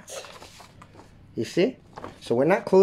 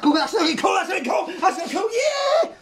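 excited; 14 semitones higher than usual. Real, not acted. Excited praise of drawing.

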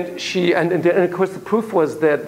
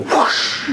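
breathy motorcycle whoosh; imitated motorbike rushing past; whoosh sound. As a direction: blow it out like a bike zooming off.

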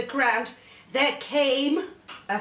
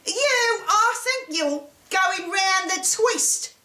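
high-pitched, scornful mother; hissing scorn; 7 semitones higher than usual. A narrator's reading. Mother to Jack about his giant story, hissing. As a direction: begin high and scoff at the boy. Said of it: "his mother hissed".

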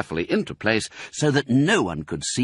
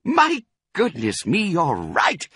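high, delighted realisation; surprised cry; 10 semitones higher, 3 dB louder than usual. A narrator's reading. Fox sees his cub is right, crying out surprised. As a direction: exclaim as it dawns on you. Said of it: "cried Mister Fox".

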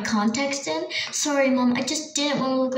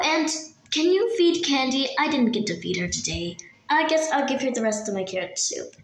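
ordinary talk

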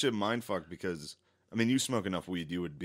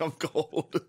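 high mock complaint through laughter; freezing kid; 7 semitones higher than usual. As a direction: play the cold kid while laughing. Listener mimics freezing kid, laughing.

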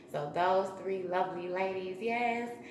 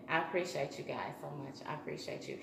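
sincere thanks; 6 semitones lower, 8 dB quieter, faster than usual. Real, not acted. Closing thanks, soft.

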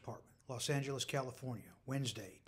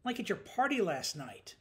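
higher, louder sulky whine; whining; 6 semitones higher, 5 dB louder than usual. A narrator's reading. Coworker sore about last night's party, whining. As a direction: whine it as a grievance. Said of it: "he whined".

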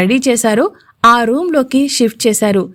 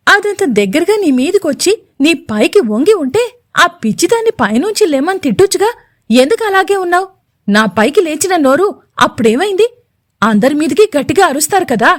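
much higher, heated reproach; serious; 6 semitones higher than usual. A narrator's reading. Swapnika confronts her husband, much higher and heated. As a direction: demand answers, indignant. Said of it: "మళ్ళీ సీరియస్గా అంది".

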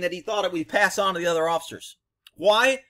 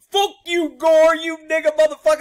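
very high, loud mock tirade; imitated furious cursing; 11 semitones higher, 10 dB louder than usual. Suspect cursing back at the cop, mock-furious yelling. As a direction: curse back in a hammed-up yell.

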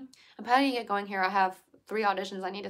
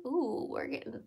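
ordinary talk, nothing marked

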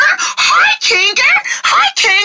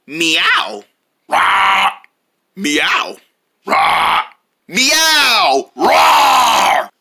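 animal noises; 3 semitones lower, much slower than usual. Pretend. Pets meowing and roaring.

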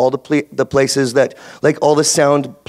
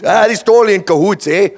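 higher, louder hammed-up Canadian accent; 6 semitones higher, 4 dB louder than usual. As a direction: play up the Canadian accent with a big eh. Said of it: "A Canadian says cahoots".

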